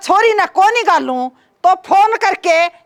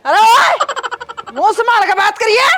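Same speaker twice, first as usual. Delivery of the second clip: angry shout; 4 semitones higher than usual. Acted. Angry outburst at drunk.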